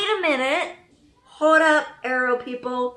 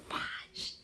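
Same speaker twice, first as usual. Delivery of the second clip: whispered exclamation; hushed, voiceless disbelief; stunned whisper; 17 dB quieter than usual. Real, not acted. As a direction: breathe it out under the breath.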